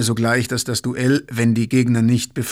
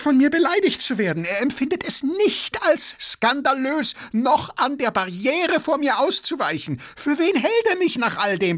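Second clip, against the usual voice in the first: very high, frantic outrage; furious tirade; 13 semitones higher than usual. A narrator's reading. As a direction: rant at your second, voice pitched right up. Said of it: "außer sich vor Wut". (außer sich vor Wut)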